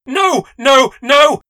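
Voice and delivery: high, loud panicked cries of no; desperate screams. A narrator's reading. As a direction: scream it as the lock clicks. Locked out, door slams.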